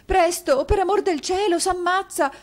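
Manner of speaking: high, loud, terrified urging; frantic cry that he'll kill himself; panicked plea. A narrator's reading. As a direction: beg for help before it's too late. Wife fears husband's suicide.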